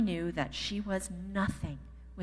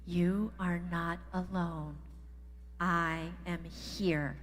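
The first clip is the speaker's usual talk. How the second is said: slow group recital; slower than usual. Shown as a demonstration. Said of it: "Guys, say it with me".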